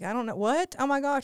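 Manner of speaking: high, startled and embarrassed; flustered surprise. Retold or imitated. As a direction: act caught off guard and mortified. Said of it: "And I'm like".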